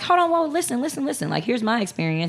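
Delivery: high, urgent hold on; imitating someone jumping into the comments; mock commenter cutting in. Commenter interrupting an argument, high and urgent. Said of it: "five other people in my comments like".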